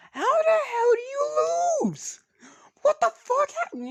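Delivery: slow, very high, loud screech; yelled outburst. Retold or imitated. Issei yelling over the announced loss, screeching. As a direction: screech it in outraged disbelief. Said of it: "that's the only thing you hear".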